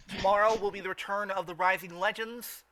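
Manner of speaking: ordinary talk, nothing marked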